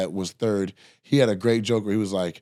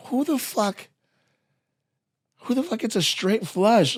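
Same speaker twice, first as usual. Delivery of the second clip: high, incredulous reaction with a long pause; retold disbelief; 10 semitones higher than usual. Loser staring at a straight flush, high retold disbelief. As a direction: go high in baffled disbelief, restarting after a pause. Retelling disbelief at losing hand.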